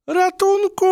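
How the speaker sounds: very high, loud cry; a frightened mouse yelling for help. Acted. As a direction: shout the moment the snout is free. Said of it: "krzyknęła Matylda".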